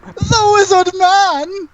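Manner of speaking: very high, slow proclamation; excitable wizard creature. Acted. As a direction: proclaim the title grandly, way up high. Game master voices the wizard creature.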